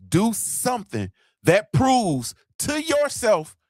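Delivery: high, emphatic, drawn-out yell; real yelling. Real, not acted. Fired-up coach yelling a challenge at his listeners. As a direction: yell it slowly, word by word. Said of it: "I'm sorry for yelling".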